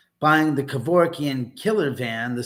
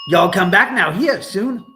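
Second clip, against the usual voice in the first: bright, loud Southern hillbilly send-off; hillbilly farewell; 6 semitones higher, 7 dB louder than usual. Retold or imitated. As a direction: belt it like a Beverly Hillbillies goodbye. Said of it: "That was from the Beverly Hillbillies".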